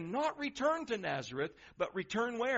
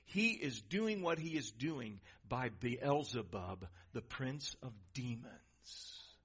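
low, soft, slow muttered charge; a low, soft voicing of the accusers; dark hushed accusation; 7 semitones lower, 5 dB quieter, slower than usual. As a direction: let it fall low and sinister. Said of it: "they even say this".

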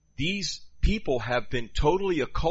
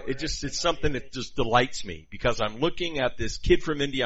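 ordinary talk

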